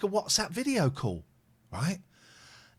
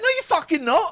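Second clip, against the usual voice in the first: much higher, louder, half laughing; laughing retold yell; 13 semitones higher, 8 dB louder than usual. Driver snaps back at the van man, laughing yell. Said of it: "And I went".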